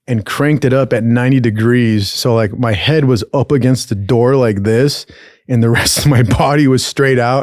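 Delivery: ordinary talk, nothing marked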